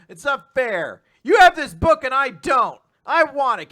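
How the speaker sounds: put-on high, loud childish yell; whiny demanding yell. Ogata yelling at the girls for the yearbook, whiny. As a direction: demand it like a grabby kid.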